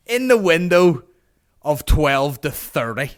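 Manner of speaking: loud, high and drawn out; exasperated emphasis. Retold or imitated. Comic answering great auntie, loud exasperated emphasis. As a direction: spell it out slowly and loudly as if obvious. Mock exasperated reply to aunt.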